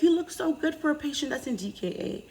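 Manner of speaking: a light imitation of a surprised doctor; mock doctor voice. Telling viewers what the doctors said, in a high mimicking voice. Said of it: "they're like".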